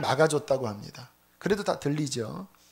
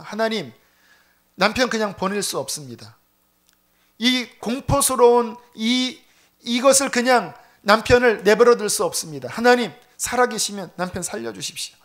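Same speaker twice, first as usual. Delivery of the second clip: louder, higher, pleading cry to God; desperate prayer; 5 semitones higher, 6 dB louder than usual. Retold or imitated. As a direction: beg God with frantic intensity. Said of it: "미친 듯이 매달렸대요". (하나님께 절박하게 매달리는 기도)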